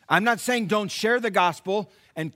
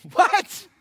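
much higher, loud laughing "What?"; incredulous laughing yelp; laughing disbelief; 14 semitones higher, 9 dB louder than usual. Retold or imitated. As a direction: crack up in disbelief.

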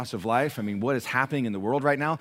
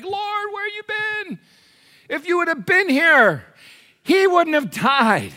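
drawn-out, very high wail of reproach; Mary's distressed cry; 7 dB louder, slower than usual. Retold or imitated. Grieving sister running out to Jesus, high drawn-out reproach. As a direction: act out a grieving sister crying out. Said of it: "Mary comes running out and she's like".

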